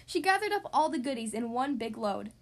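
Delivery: plain reading voice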